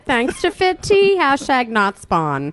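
put-on high valley girl voice; influencer parody. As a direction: vapid influencer gushing. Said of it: "that other chick is".

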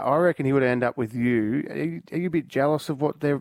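ordinary talk, nothing marked

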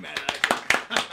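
slow, drawn-out announcer call. Acted. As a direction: announce the name like a ring MC. Host announcing guest name.

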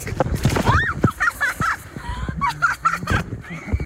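high shrieking laugh of glee; big laugh. Real, not acted. Girl laughing after pushing dad in.

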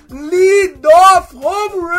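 slow shouted home run call. Real, not acted. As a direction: stretch out the call loudly. Celebrating home run live.

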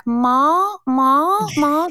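high, excited little American "Ma, Ma, Ma"; American toddler accent. Retold or imitated. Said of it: "in, like, an American accent".

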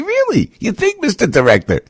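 high, mocking "really, you think?"; sarcastic disbelief. Real, not acted. Host mocking the FBI director's obvious warning, sarcastic and high.

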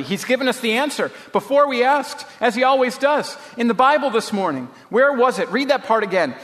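high, eager rush of discovery; excited. A narrator's reading. Betsy tells Corrie of God's answer, excitedly. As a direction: pitch it up, bursting with the answer. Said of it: "she said excitedly".

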